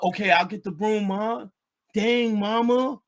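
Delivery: put-on sulky teen giving in; meek son. Son answering mom meekly.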